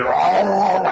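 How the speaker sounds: high, loud-ish greedy eating noise; hammed-up feasting moan; mock gorging groan. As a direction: groan like you are gobbling noodles. Acting out greedy noodle eating.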